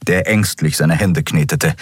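plain reading voice

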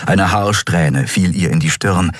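ordinary delivery

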